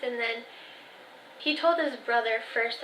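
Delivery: ordinary delivery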